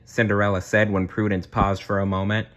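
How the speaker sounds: ordinary delivery, nothing marked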